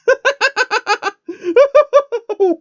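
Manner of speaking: loud high burst of laughter; the reader laughing hard, high and loud; real laugh. Real, not acted. Reader cracks up at line.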